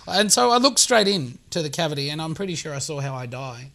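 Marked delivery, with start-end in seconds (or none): none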